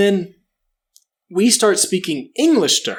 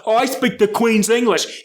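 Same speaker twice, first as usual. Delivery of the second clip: mock British accent; 6 semitones higher than usual. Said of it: "your fucking accent sounds like".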